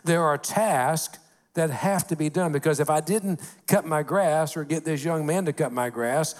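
ordinary delivery

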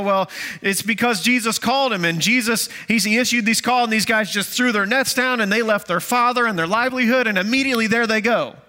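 mock a preacher's excited explanation; imitating preachers. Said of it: "preachers say".

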